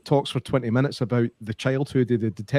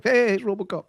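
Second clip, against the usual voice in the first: high-pitched retold delight; imitating a thrilled viewer; excited recognition; 9 semitones higher than usual. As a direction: exclaim it like spotting a favourite character. Retold delighted reaction to spotting RoboCop.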